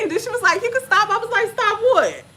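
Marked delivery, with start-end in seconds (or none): 0.6-1.1 high light voicing of the doctor; high mock doctor. Doctor telling her to stop pushing, high light voicing. 1.5-2.2 high baffled question; confused high reply. Retold or imitated. To her doctor, confused why she should stop, high baffled ask.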